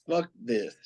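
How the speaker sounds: nonchalant. Retold or imitated. Paraphrasing the villain's reaction, nonchalant. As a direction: shrug it off under the breath. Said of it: "Just so nonchalant".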